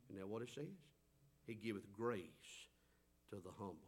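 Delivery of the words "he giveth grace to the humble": soft hushed. Acted. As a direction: let the promise fall softly. Repeats verse hushed.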